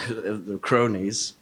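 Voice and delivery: usual speaking voice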